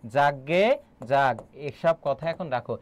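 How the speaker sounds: slow, drawn-out example phrase; slow emphatic repeat. Shown as a demonstration. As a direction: stretch the interjection out for the class. Teacher repeats example slowly for class. (ধীরে টেনে টেনে বলা)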